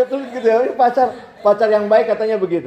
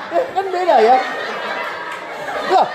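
much higher retort breaking into laughter; laughing; 9 semitones higher than usual. Real, not acted. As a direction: crack up at your own comeback. Preacher retort then laughs. (jawaban tinggi lalu tertawa terbahak)

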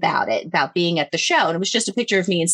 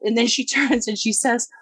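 ordinary talk, nothing marked

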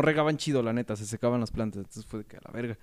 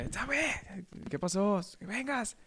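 much higher, impatient mother calling; mom calling; 9 semitones higher than usual. Retold or imitated. Mother shouting for her son to come help, high and impatient. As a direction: pitch it way up like a nagging mom.

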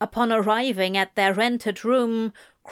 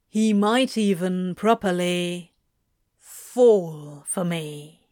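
lightly voiced demon, slowing at the end; Crowley's scheming musing; 3 semitones lower than usual. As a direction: let the last words drop low and drawn out. Crowley speaking in character.

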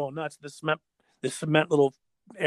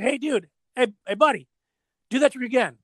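higher-pitched mock call to a skater; 7 semitones higher, 4 dB louder than usual. Photographer asks a skater to redo the trick, calling out. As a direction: call over a buddy, pitched up.

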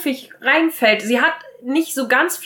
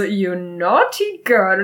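slow, suggestive English line; drawn-out mock-seductive tease; teasing Bates; 4 semitones lower, much slower than usual. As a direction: savour each word like a flirt. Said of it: "dann sagt Bates nur so richtig so".

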